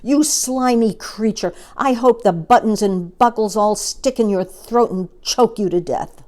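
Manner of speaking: loud, scolding monkey outburst; indignant cry. A narrator's reading. Monkey cursing the child-eating crocodile, loud outrage. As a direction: hurl the curse out loudly. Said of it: "cried Mugglewump".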